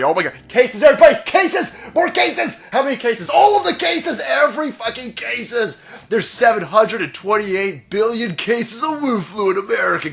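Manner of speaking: shrill high mock panic, hammed up; over-the-top mocking panic voice; mocking the scared left. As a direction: parody a hysterical person listing cases. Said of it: "I'm sitting here going".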